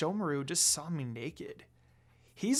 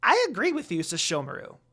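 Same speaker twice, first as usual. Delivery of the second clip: high, loud eager little servant voice; high loud imp reporting to his master; squeaky servant imp; 4 semitones higher, 4 dB louder than usual. Acted. Jokin voice.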